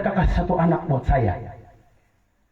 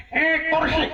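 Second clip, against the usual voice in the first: a high, sudden cry of "Eh, kursi", yelped like a mother mid-labour; startled blurt; 10 semitones higher, slower than usual. Retold or imitated. Mother in childbirth blurting the first word, startled high yelp. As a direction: blurt "Eh, kursi" as if caught by surprise. Mother names baby at birth.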